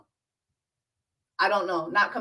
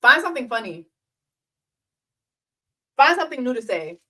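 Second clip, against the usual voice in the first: louder, firmer jab; louder mock comeback; mock push back; 2 semitones higher, 6 dB louder, faster than usual. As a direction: push back hard on the joke. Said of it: "That's pushing back".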